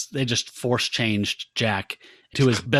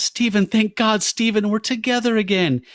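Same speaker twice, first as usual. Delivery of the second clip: much higher, gushing mock relief; joyful heroine; 10 semitones higher than usual. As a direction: swoon back into his arms. Elaine reunited with husband.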